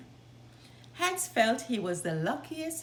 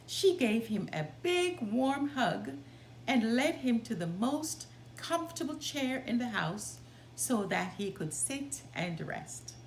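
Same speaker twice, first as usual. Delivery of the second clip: ordinary delivery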